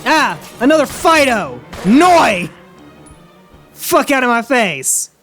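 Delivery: loud panicked shouting; startled yell. Real, not acted. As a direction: yell in sudden alarm. Second zombie dog attacks.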